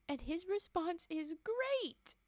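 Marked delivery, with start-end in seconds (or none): 1.4-2.1 high, loud fake enthusiasm; over-bright sarcastic cheer; mock cheery. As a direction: chirp it with sarcastic glee. Mock cheery reply in retold exchange.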